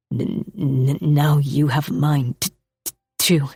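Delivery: low, halting voice, stuttering shyly; a shy man tripping over his words; nervous stammering man. Acted.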